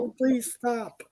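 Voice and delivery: high, slow plea; playfully pained, drawn-out pleading; mock exasperation. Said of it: "it just drives me crazy".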